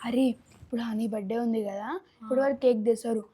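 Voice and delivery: very soft, low aside to the group; hushed scheming. Acted. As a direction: plot it under your breath. Aside plotting to share the cake. (గుసగుసగా పన్నాగం చెప్పడం)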